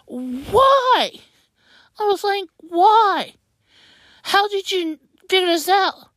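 high-pitched, incredulous questioning; retold dismay. Sibling hearing of brother's breakup, dismayed disbelief. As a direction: reenact disbelief, pitch jumping up on each question.

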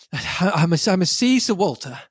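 out of breath, stammering; panting. A narrator's reading. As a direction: gasp it out after running. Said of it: "I panted".

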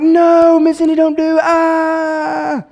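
put-on panicked children crying no to the teacher; kids freaking out. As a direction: shriek it like frantic kids in class. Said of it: "the kids are, like, freaking out".

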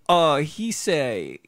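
halting broken English in a mock Korean accent; lightly hammed Korean accent, stumbling through the thanks. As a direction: imitate the frozen translator in a Korean accent, hesitant. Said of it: "Why are you doing the accent".

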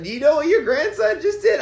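high, telling-on voice; imitating mom. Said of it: "it's like".

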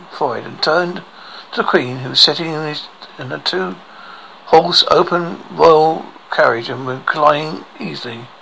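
ordinary delivery, nothing marked

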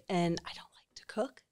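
soft whisper of a guilty admission; whispered confession. Real, not acted. Confessing she doesn't cook.

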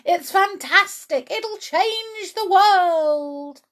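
high, loud rapture building to a drawn-out cry; excited dad. A narrator's reading. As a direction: get swept away by your own vision.

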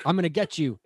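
high, impish threatening voice; sneering little leprechaun. Retold or imitated. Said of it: "good Leprechaun impression".

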